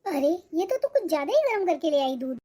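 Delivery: ordinary talk, nothing marked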